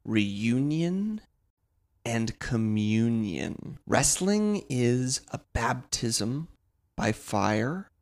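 slow, grand preaching voice; solemn sermon. Acted. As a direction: preach it slowly like scripture. Solemn wrestling sermon closer.

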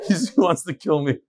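talks through laughter; laughing. Real, not acted. Teacher joking the rebbe will kill him, laughing. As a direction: laugh while you speak.

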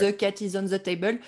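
quick, put-on heavy French accent. Shown as a demonstration. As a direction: revert to schoolroom French-accented English. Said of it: "go back to the French accent".

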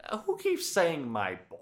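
lightly voiced, high-pitched retold question; imitating Paul Heyman. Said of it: "Paul's all like".

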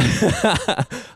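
high burst of laughter; real laugh. Real, not acted. Preacher laughing at his own dad aside, real laugh. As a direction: laugh at your own joke.

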